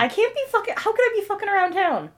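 high, outraged squeal; indignant protest. Real, not acted. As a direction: protest shrilly, way up. Indignant denial, voice jumps high.

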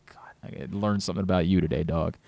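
low whispered mock aside. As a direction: hush it like a secret jab.

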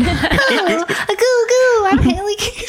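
put-on very high cooing baby voice; goo-goo baby talk, played for laughs; Haley Keene as a baby. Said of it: "You sound like a baby".

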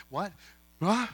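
ordinary talk, nothing marked

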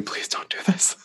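high, soft whispered plea; pleading whisper. Retold or imitated. Reader going into the book fearing the worst, pleading whisper. Acts out dread before reading.

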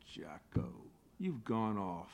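low, soft, slow lament; dismayed. Acted. Wife mourns her collapsed husband, low, soft and slow. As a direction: sigh it over the fallen husband.

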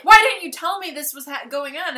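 imitating her loud, high outrage; acted super mad. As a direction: demand it angrily, playing the character. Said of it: "she's super mad".

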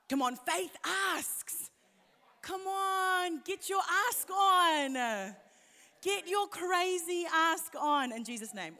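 high, slow, fervent urging; urging exhortation. Real, not acted. Preacher urging the congregation to ask boldly, high and slow. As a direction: lift them up to ask boldly.

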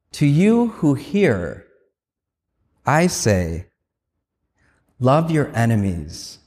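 slow, spaced-out words of Jesus; Jesus speaking to you. Acted. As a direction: let each phrase land slowly, with long pauses. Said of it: "you hear his voice say to you".

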